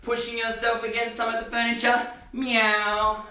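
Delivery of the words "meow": playful mock meow for the kids; cat meow. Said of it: "can you be a cat".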